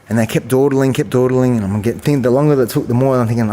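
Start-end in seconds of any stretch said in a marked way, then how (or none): none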